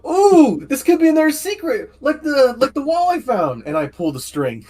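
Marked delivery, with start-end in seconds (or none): 0.1-3.6 much higher, eager voice; excited character voice. Acted. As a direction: squeal with delight at a secret. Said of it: "and go like".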